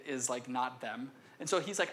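normal talking voice

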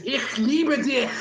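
slow, lightly hammed German love line. Shown as a demonstration. Teacher mocking German as unromantic, slow German. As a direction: drawl Ich liebe dich slowly. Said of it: "The way to say I love you".

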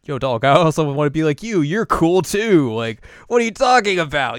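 acted-out exuberant pep talk; hyped reassurance. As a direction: protest emphatically like a hyped buddy. Acts Kanan's hyped reply.

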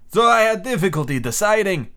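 a composed sea captain laying down terms; a lightly voiced stern commander. The captain sets his terms for the prisoner, lightly voiced.

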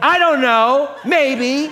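high, loud, hesitant mock shrug; unsure congregation. Pastor imitating an unsure congregation, loud and high with a laugh. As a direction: voice the crowd's wishy-washy doubt way up high. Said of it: "The rest of you are like".

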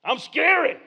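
loud, high swooping spook cry; mock scary devil. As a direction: play a harmless bogeyman hamming it up. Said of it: "the devil can sound scary".

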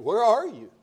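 lightly acted searching call; God calling out. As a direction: call out for someone hidden. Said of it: "God calls again".

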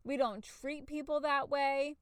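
high-pitched parent's scolding, imitated; mock screaming. Mother imagining telling off her daughter, mock scream. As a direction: act out a flip-your-lid scolding, pitched high without full yelling. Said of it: "screaming like".

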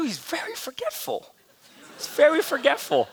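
high, light mock-wife voice; imitated wife. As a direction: gently excuse him in a put-on high voice. Imitates wife excusing his forgetfulness.

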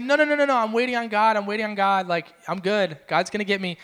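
imitated stubborn man declining help; insistent refusal. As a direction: wave off help, sure of yourself. Drowning man waves off rescuers.

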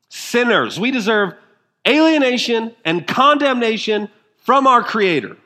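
high, loud preacher's cry; fervent pulpit climax; preaching at full force. Acted. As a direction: lift the congregation with a ringing proclamation.

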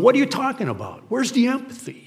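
high, quick, offended retort; mock defensive protest. Criticized person answering back, mock defensive protest. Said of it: "Or somebody going".

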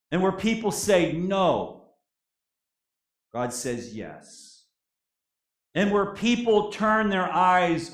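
loud, rising preacher's cadence with heavy pauses; a preacher declaring what God says; preaching at full force. Acted. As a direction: proclaim it to the whole congregation. Opening declaration of the sermon.

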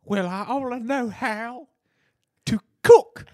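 high, slow, cracking admission; squeaky confession. Acted. Chef confessing to the owners, voice cracking high. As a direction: let the voice squeak up on cook. Chef admits he can't cook.

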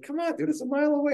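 high pleading whine; high drunken coaxing. Retold or imitated. Begging friends to drive drunk.

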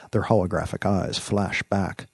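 ordinary delivery

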